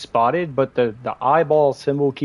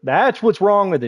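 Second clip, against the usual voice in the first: louder and higher, really hammering "what's"; emphatic; 8 semitones higher, 6 dB louder than usual. Real, not acted. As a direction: punch "what's" like a verdict. Says line twice, second louder.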